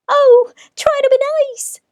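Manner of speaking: big lion begging like a frightened kitten; whimpering lion. Acted.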